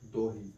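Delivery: low, soft दोही with o in the first syllable; Sikhariya Khortha pronunciation. Shown as a demonstration. Teacher repeats the Khortha answer for students, दोही with rounded o. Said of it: "क्या बोला जाता है?".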